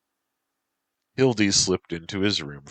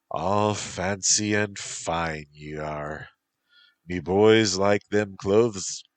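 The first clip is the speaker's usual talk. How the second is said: slow Irish lilt, mildly voiced; Irish accent; 4 semitones higher, 4 dB louder, slower than usual. Pirate captain sizes up the dandy in a slow Irish accent. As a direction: draw it out in a light Irish brogue. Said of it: "in an Irish accent".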